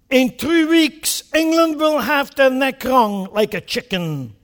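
grave parliamentary address; Churchill quote. Retold or imitated. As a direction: recount the warning with scorn. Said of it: "He said".

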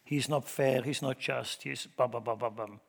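ordinary talk, nothing marked

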